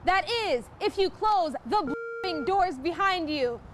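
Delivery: ordinary talk, nothing marked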